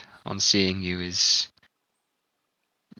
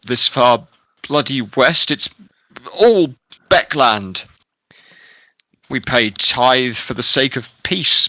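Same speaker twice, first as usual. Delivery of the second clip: hammy stage actor; 2 semitones higher, 7 dB louder than usual. Acted. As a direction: bluster like an outraged aristocrat. Noble keeps blustering.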